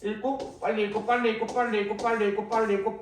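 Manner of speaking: mock a student racing through the text; rattling it off like a panicked student; rushed reading demo. Shown as a demonstration. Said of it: "빨리 읽어야 된다는 생각이".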